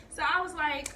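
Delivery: ordinary talk, nothing marked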